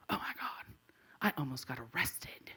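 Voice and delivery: low, breath-only mock horror; horrified whisper. Woman realizes she almost got arrested, horrified whisper. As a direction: whisper it as it dawns on you.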